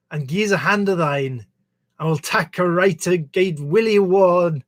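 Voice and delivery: slow verse recital in a clumsy Scottish accent; bad Scottish accent. Shown as a demonstration. Apologetic reader massacring Auld Lang Syne, poor Scottish accent recitation. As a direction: attempt Scots, drawing out the refrain endings. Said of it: "get a Scot in here to do the accent properly".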